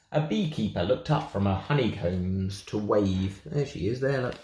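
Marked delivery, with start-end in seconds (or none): none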